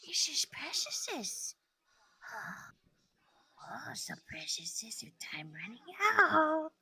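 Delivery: Gollum whisper. Acted. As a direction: creep it out like Gollum. Keeps doing Gollum.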